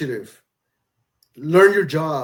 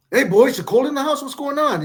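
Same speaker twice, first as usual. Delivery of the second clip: higher-pitched, pressing dad questioning; retold fatherly challenge, pitched up; dad checking on son; 9 semitones higher, 3 dB louder than usual. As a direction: quiz your boy like a concerned dad. Dad scolding son over screen.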